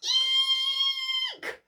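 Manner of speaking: very high shrill eek; a big lion shrieking like a mouse; high squealed eek of fright; lion's high eek. Acted. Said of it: "let out a".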